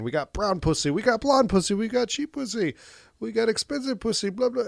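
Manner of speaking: high-pitched hawker's list; bar barker. Retold or imitated. Host imitating the man outside the bar, high barker's patter. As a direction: call out the offers like a doorman. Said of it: "he's the exciter".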